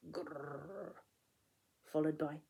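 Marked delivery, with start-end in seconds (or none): 0.1-0.9 a low, muffled teddy-bear growl, much softer. Acted. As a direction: grumble grrr as if from under a pile of boxes. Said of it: "he heard a muffled".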